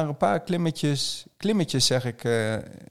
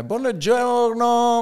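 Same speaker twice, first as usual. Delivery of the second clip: Italian greeting; 10 semitones higher, 4 dB louder, much slower than usual. Retold or imitated. Knocking on pension door, Italian greeting.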